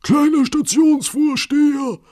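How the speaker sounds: high, loud, drawn-out call; urgent shout at a very high pitch; very excited conductor. A narrator's reading. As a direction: cry out in great agitation. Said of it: "rief der Schaffner ganz aufgeregt".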